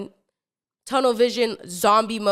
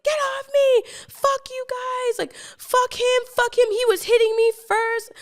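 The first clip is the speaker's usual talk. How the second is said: furious shriek. Retold or imitated. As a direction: scream at the guys holding you back. Girl held back, hitting the boys.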